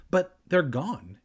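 usual speaking voice

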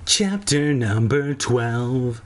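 slow, drawn-out announcer read; chapter announcement. Shown as a demonstration. Reading aloud, a narrator announcing the chapter slowly. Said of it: "chapter announcement".

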